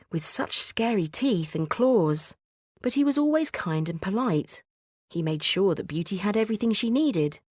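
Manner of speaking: ordinary delivery, nothing marked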